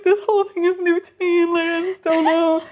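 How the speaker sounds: high-pitched, hammed-up helpless plea; mock distress. Retelling her panicked confession to her date, mock distress. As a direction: imitate yourself overwhelmed, voice way up.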